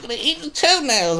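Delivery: high, loud, drawn-out silly voice; exaggerated squeaky put-on voice; mock toenail eater. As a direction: imitate her munching greedily, hammed up. Imitates woman eating toenails.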